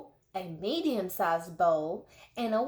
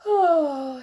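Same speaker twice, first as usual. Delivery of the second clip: sleepy yawned oh; yawning; 6 semitones higher, 7 dB louder than usual. A narrator's reading. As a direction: say it through a yawn. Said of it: "Daisy Locks yawned".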